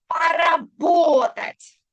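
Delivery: Поработать very slow and loud, stress on po-ra-BO-tat; slow loud demo. Shown as a demonstration. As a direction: call out Поработать syllable by syllable for the class. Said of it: "tilde на о".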